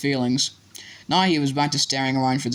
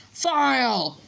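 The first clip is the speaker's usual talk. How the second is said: high, drawn-out cry at normal volume; mock scream; 11 semitones higher than usual. A narrator's reading. Gryffindors protesting the block, restrained scream. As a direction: cry foul like an outraged crowd. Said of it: "screamed the Gryffindors".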